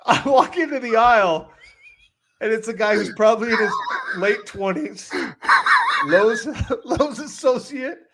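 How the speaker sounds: high, helpless laughing voice; laughing while talking. Real, not acted. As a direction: struggle to get the story out through laughter. Telling punchline while overcome by laughter.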